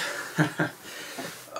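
short voiceless laugh, acted; breathy chuckle. Laughing in character on arrival.

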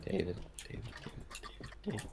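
whispering. Real, not acted. Calling him in a whisper.

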